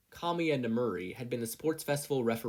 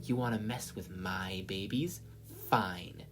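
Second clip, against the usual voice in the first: menacing whisper, lower; cold whisper; 3 semitones lower, 4 dB quieter, slower than usual. Acted. Mei threatening Mina over her babies, whispered. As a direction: threaten under your breath. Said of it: "barely audible voice sounding cold and desolate".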